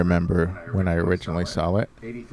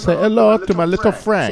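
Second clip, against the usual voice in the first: loud, high Cuban gangster accent on hello; 11 semitones higher, 9 dB louder than usual. Shown as a demonstration. Cohost showing the right way, loud Cuban accent. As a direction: belt it out in a Tony Montana Cuban accent. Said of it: "offensive if I say it like that".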